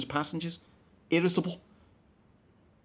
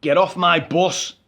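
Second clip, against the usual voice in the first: retold shout; 7 dB louder, slower than usual. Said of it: "he shouted".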